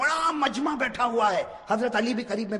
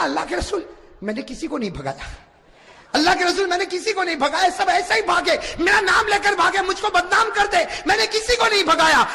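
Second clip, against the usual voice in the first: Umar pleading; 10 semitones higher, 4 dB louder than usual. Acted. Trembling Umar pleads innocence.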